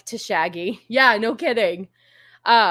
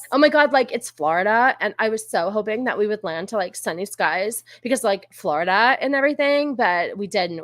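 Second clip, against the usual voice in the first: hammed-up Valley girl complaint. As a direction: put on a dramatic California Valley girl. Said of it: "keep talking like the Valley girl".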